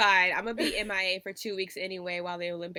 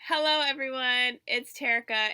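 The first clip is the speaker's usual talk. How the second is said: normal talking voice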